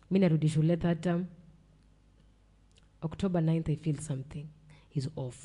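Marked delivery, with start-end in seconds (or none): 3.7-5.5 whisper. Retold or imitated. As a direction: whisper that something is off. Sensing something wrong at school.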